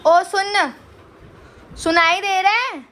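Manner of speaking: loud, insistent wake-up call. Real, not acted. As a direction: call sharply to rouse a sleeper. Calling out to her sleeping brother.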